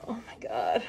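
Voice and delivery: breathy whisper of awe; awed whisper. Real, not acted. Reader awed by the spread, whispering. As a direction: whisper it, struck by the reading.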